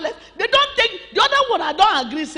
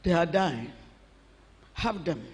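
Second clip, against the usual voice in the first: defeated murmur; 8 semitones lower, 9 dB quieter than usual. Acted. King hands everything over.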